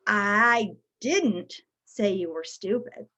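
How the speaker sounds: "didn't" set apart and stressed, slow and low. Shown as a demonstration. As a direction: slowly punch the word "didn't". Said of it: "depending how we emphasize the word".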